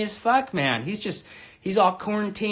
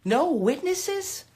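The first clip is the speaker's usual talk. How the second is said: mock outrage; 8 semitones higher than usual. Real, not acted. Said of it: "This is the problem".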